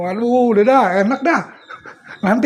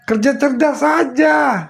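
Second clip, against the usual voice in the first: slow, raised call; emphatic; 6 semitones higher, slower than usual. Real, not acted. Preacher's emphatic punchline.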